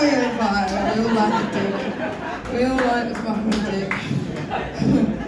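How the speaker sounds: ordinary delivery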